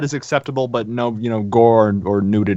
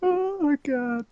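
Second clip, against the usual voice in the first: laughing; 11 semitones higher, 8 dB quieter, slower than usual. Real, not acted. Cracking up at the potatoes line.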